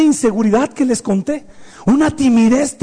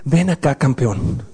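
gentle "campeón"; 10 semitones lower, slower than usual. Retold or imitated. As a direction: call your son over tenderly, voice dropped well down. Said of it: "Cuando estoy enojado, digo". (voz baja y tierna, incluso enojado)

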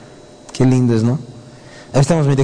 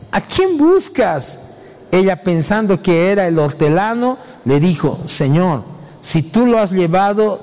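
slow, deliberate Bible reading; raised, measured recitation with long pauses; scripture reading; 4 semitones higher than usual. Acted. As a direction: read the verses out, drawing out phrase ends. Reads scripture aloud.

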